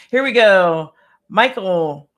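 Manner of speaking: ordinary delivery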